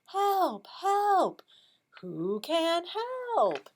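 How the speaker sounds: high, loud cry for help in singsong; duck calling for help. Acted. Teacher reads the stuck duck's refrain for choral reading, high calling voice. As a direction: call out high, stretching the last question. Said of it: "what does the duck say".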